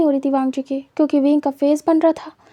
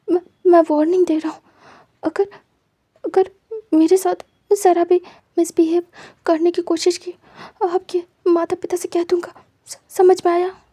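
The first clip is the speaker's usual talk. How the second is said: higher, fast, near tears, stammering; panicked tearful warning; 3 semitones higher than usual. Acted. Wing, panicking and tearful, threatens to tell Wang Ji's parents. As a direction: blurt the threat out through rising panic. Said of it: "घबरा जाता है।".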